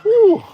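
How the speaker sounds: very high whistling whew of effort; high exhausted whew. Real, not acted. Tired owner on the walk, a high puffed whew. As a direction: blow it out, worn out. Can hardly walk today.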